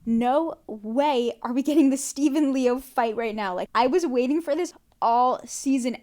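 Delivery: loud, gleeful fan excitement; loud fan hype over the awaited fight; excited yelling. Real, not acted. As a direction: shout it like the fight finally came. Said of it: "I was screaming".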